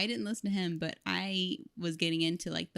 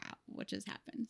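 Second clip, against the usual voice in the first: whispered awe; 3 semitones higher, 11 dB quieter than usual. Retold or imitated. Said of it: "I was just like".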